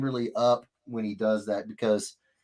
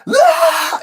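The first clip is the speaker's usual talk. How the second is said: put-on sudden high, loud baby shriek; dad imitating baby's instant scream, high and loud; imitated baby scream; 10 dB louder than usual. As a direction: let out a sharp baby wail.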